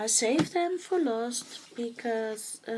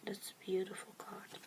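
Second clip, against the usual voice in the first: whisper; 2 semitones lower, 10 dB quieter than usual. Real, not acted. Admiring the angel card.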